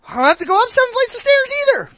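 high, fast put-on whine; mock whiny worker. Preacher mimicking a worker who shuns the stairs, fast high mock whine.

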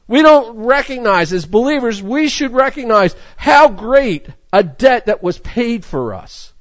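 fervent sermon delivery; preaching. Real, not acted. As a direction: press the point home from the pulpit. Preaching at full force.

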